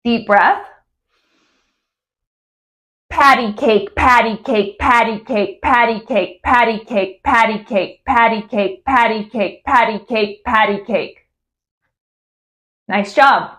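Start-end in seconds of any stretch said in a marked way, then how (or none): 3.1-11.3 patty cake repeated crisply in a steady rhythm; over-articulate each patty cake evenly; clear patty cake drill. Shown as a demonstration. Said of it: "one more time with the word patty cake".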